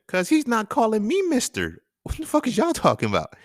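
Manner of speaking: loud, high retold outburst; indignant retort. Acts out his indignant answer.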